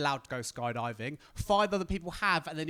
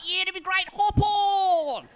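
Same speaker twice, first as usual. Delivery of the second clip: much higher, louder mock shout; hyped skydiver shout; 8 dB louder, much slower than usual. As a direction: bark him onto your back. Skydiver urges him on.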